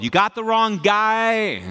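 high, loud, drawn-out mock wail; exasperated protest. Young pastor telling God he's wrong, exasperated protest. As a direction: stretch it out in comic disbelief. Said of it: "tell God that he's wrong".

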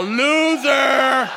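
loud, high laughing yell of "Loser!"; gleeful shout. Retold or imitated. Boys mocking mom pulled over, loud laughing yell. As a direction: boys yelling out the window. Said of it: "my boys are all".

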